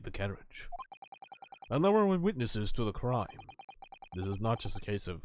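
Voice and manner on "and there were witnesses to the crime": ordinary talk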